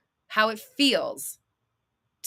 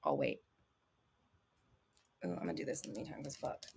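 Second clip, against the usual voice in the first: aside; 11 semitones lower, 17 dB quieter, faster than usual. Real, not acted. Muttered aside to herself.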